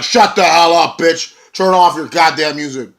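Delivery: loud, high, furious yell; angry shout. Retold or imitated. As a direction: cut her off with a furious shout. EC3 cutting off Maria.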